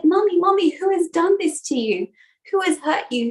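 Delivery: high, urgent cry to mummy; a shocked child demanding who hurt mum; shocked child Ganesh. Acted. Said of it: "And Ganesh was shocked".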